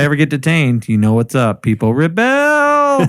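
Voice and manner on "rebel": very high drawn-out yell; hammed-up rebel shout; mock battle cry. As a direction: shriek it like a rally call. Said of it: "I don't know why I said it like that".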